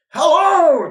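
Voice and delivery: loud high greeting shout; shouted hello. A narrator's reading. Townsfolk greeting each other, shouting. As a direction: call it out across the street. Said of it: "they shouted as they met".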